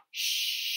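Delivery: breathy shaking shh; shaky shushing sound. Shown as a demonstration. Storyteller showing children the shaking sound, a long shh. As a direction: shush like a rattling shaker. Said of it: "a shaky sound like this".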